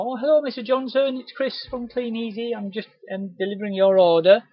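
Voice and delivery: high, bright friendly salesman hello; cheery doorstep greeting. Retold or imitated. Rep greeting a customer at the door, cheery.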